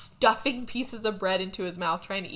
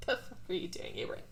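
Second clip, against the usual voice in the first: whispered; 10 dB quieter, faster than usual. Retold or imitated. Retold own reaction, breathy.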